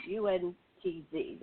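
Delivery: usual speaking voice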